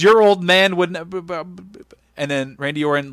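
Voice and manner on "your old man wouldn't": mock loud. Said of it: "and he goes".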